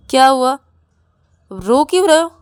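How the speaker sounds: loud, high, impatient questioning; sharp demand. Acted. Rahul asks why Ravi is crying, loud demand. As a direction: raise your voice and demand an answer.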